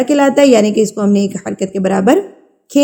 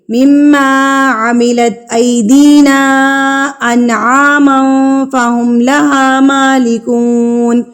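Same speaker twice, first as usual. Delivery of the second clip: slow, drawn-out tajweed chant; slow melodic Quran recitation for students; 2 semitones higher, much slower than usual. Shown as a demonstration. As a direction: recite each syllable slowly and melodically.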